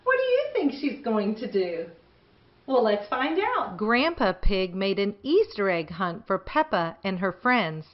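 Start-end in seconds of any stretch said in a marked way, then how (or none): none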